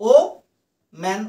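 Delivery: ওম্যান broken as ও, long pause, ম্যান; split in syllables. Shown as a demonstration. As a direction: say ও and ম্যান as two separate beats. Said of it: "দুবারে কিন্তু ওয়ার্ডটা উচ্চারিত হচ্ছে". (ওম্যান দুই ভাগে থেমে বলা)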